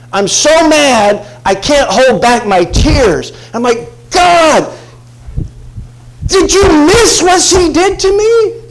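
furious high, loud rant, drawn-out words; high-pitched aggrieved shouting, near tears with anger; ranting madman. Retold or imitated. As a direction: rage at God at the top of your voice. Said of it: "rant and rave like a madman".

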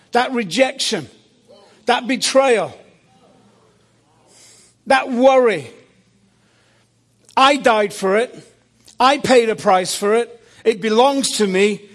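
high and loud, building to a final cry; each item a hammer blow up to the demand; loud passionate claim. Retold or imitated. Jesus claiming back what he died for, loud building cry.

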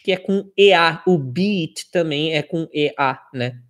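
ordinary talk, nothing marked

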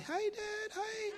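very high falsetto little voice; Jacob. Acted. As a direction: pipe it up high, a comic giveaway voice. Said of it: "Can't do nothing about your voice".

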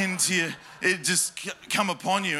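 ordinary delivery

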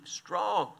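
usual speaking voice

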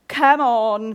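loud coaxing; loud come on, come on; rallying call. Real, not acted. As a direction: rouse the congregation. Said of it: "Be with me here now".

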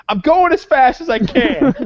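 high, loud imitation of a defensive waiter; flustered waiter. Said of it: "the guy thought I was yelling at him".

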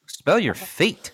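lente, plus aiguë, incrédule; redis-le lentement, sidéré; répétition incrédule. Real, not acted. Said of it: "What the fuck?".

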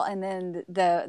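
ordinary talk

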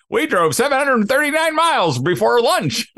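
high, proud mock dad voice; boastful dad. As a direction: brag like a road-trip dad. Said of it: "dads are like".

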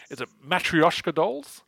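usual speaking voice

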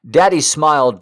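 usual speaking voice

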